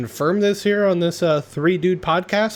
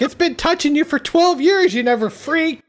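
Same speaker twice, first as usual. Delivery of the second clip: high-pitched retort in an acted quarrel; hammed-up arguing; mock exasperation; 11 semitones higher than usual. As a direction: act out an exasperated comeback, pitched way up. Acted quarrel between movie couple.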